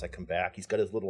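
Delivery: ordinary talk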